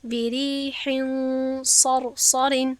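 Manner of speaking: بریحین صرصر chanted very slowly, nun nasalized; slow Quranic chant of بریحین صرصر; ikhfa recitation. Shown as a demonstration. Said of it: "من باید مخفی کرده بخوانم".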